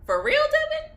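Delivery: high, loud self-scolding; mock incredulous. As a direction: call yourself out in disbelief. Mocking herself in disbelief.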